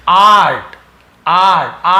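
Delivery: art with a loud, drawn-out AA; demonstrated long vowel. Shown as a demonstration. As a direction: teach art with a long open आ. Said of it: "इस प्रकार से और भी आ".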